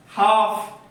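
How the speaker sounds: "हाफ" loud, long vowel and no L; silent L. Shown as a demonstration. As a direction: model "हाफ" firmly, L left out. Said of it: "इसमें हाल्फ नहीं बोलेंगे".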